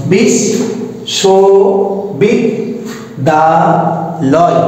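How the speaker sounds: বিশ, শো, বিদ, দা, লয় each said alone with short even pauses; counting syllables for the class. Shown as a demonstration. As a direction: tap out বিশ্ববিদ্যালয় syllable by syllable. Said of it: "বিশ্ববিদ্যালয় শব্দে আমাদের অক্ষর আছে পাঁচটি".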